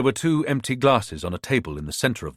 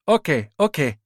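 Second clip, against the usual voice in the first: put-on 1 Ghanaian accent; 6 semitones higher than usual. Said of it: "staying with the Ghanaian accent".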